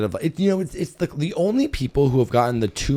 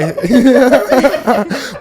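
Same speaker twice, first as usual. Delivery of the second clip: high, loud cackle; loud laughing fit; 12 semitones higher, 9 dB louder than usual. Real, not acted. Host backtracking to listeners, bursting into high laughter. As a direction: burst out laughing mid-explanation.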